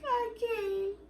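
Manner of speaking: high tearful wail; crying. Retold or imitated. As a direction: voice breaking up high, giving up. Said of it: "but I started crying again".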